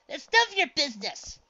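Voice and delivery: loud, high indignant scolding; loud, high protective rebuke; angry snap. A narrator's reading. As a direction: tell her off hard.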